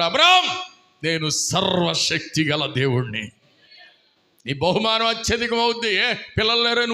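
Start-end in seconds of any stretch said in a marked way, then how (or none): 0.0-0.6 booming call of Abraham's name; God calling out. Acted. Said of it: "దేవుడు అంటున్నాడు". 1.0-3.3 slow, low and majestic; voice of God. Acted. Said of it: "దేవుడు అంటున్నాడు".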